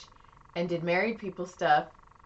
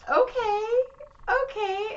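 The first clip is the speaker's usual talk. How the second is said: skeptical okay; 3 dB louder, slower than usual. Retold or imitated. Reacting to creepy client.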